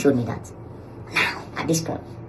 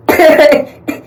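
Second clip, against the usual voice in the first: a loud, high burst of laughter; 12 dB louder than usual. Real, not acted. Narrator cracking up while retelling the standoff, loud laughter.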